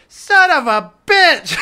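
yelled curse. Acted. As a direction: holler the curse from across the way. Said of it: "from probably, like, ten feet away, and he just goes".

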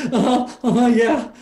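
high, nervous agreement; shaken soldier. Acted. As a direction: agree quickly in a frightened high voice. Shaken beaten soldier answers captor.

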